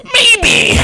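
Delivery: high, loud, throat-straining cry; garbled pained yell through gritted teeth; strained gritted-teeth shout. Acted. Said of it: "I'm trying to, like, do a gwit and through it".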